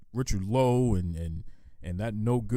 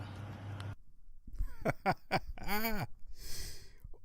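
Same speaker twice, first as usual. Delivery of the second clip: breathy laugh; 12 semitones higher, 6 dB quieter than usual. Real, not acted. Laughs at caller's message.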